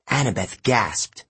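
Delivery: usual speaking voice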